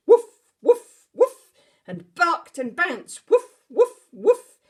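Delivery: very loud, high woofs; excited barking. Acted. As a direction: bark and bounce like a frantic dog. Bear barks and bounces.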